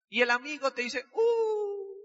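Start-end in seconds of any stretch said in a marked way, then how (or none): 1.1-2.0 very high "Uh" of mock shock; mocking surprise. Friend hearing he paid too much, high mock shock. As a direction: gasp like he's been robbed.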